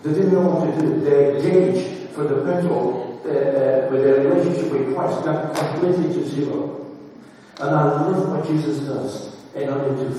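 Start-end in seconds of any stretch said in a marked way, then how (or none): 0.0-6.8 loud, ringing sermon delivery; preacher lifting his voice to the congregation; preaching at full force. Acted. Preacher telling the church about the disciples, loud and ringing.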